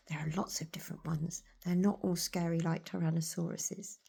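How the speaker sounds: ordinary delivery, nothing marked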